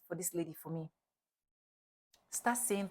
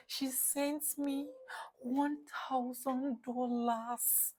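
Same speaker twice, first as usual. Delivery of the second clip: stunned; 5 semitones higher, 4 dB quieter, much slower than usual. Real, not acted. Still stunned, recounting the sum.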